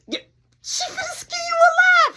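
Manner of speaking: high, loud cracking shriek; panicked shriek. Retold or imitated. Warning Mark his mom will kill him, shrieking. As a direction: screech it in panic.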